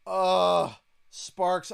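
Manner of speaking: bored whining. Retold or imitated. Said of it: "He's like".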